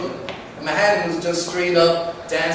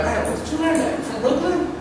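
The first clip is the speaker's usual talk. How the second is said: much higher, surprised quote; incredulous questioning; 12 semitones higher than usual. Retold or imitated. Brooklyn guy quizzing him on his moves, high incredulous questioning. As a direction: imitate an amazed stranger, pitched way up. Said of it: "and he was like".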